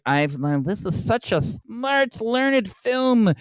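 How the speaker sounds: affected, slow gushing critic voice; mocking nerd voice, drawn out and rising high; mock film-nerd critic. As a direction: parody a self-satisfied film snob. Mocks nerd critics praising the film.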